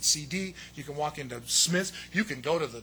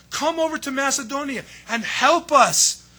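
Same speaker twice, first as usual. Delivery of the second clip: louder, higher begging plea; the man of Macedonia begging Paul, louder and higher; pleading; 6 semitones higher, 8 dB louder than usual. Retold or imitated. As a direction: beg him to come over and help. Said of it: "notice, pleaded. He's begging".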